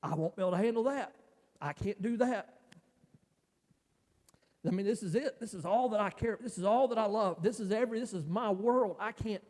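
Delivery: the fear of losing her spills out; distraught pleading. Retold or imitated. Husband pleading with his wife about their daughter, distraught.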